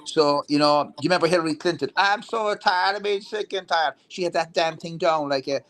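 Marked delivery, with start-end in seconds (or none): 2.0-4.0 a hint of a slow southern drawl, pitched higher; lightly done southern accent; mock southern drawl. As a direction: imitate a politician's put-on southern drawl, slowly. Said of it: "like that accent, that southern drawl".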